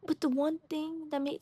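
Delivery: normal talking voice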